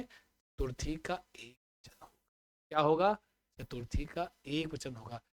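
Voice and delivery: ordinary talk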